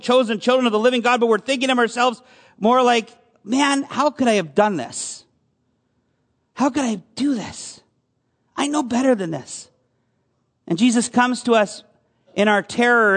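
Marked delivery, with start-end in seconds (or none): 3.4-9.7 mild self-reproach. Pretend. Voices a believer's self-reproach.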